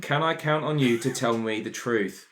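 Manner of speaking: ordinary talk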